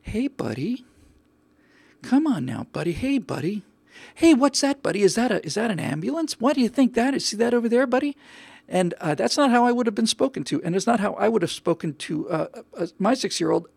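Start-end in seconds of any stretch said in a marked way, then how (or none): 0.0-8.2 imitating a sing-song, coaxing dad, rising higher; mock the cheery dad coaxing his screaming boy; mocking Groovy Dad. Mocking the dad soothing his kid on a plane, sing-song. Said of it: "Groovy Dad right next to him going".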